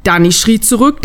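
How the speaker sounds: usual speaking voice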